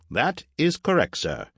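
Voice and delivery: high-pitched formal robot, dropping on the last word; high robot butler voice; robot servant. Acted. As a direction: confirm like a dutiful machine, pitched high. Robot confirms to its owner.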